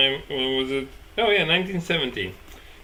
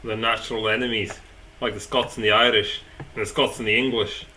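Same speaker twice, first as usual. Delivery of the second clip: lightly done cartoon Scotsman; half-decent Scottish impression listing old enemies; Simpsons Scottish accent; 6 semitones lower than usual. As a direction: attempt a comic Scottish accent, lower than usual. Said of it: "do the Simpsons Scottish".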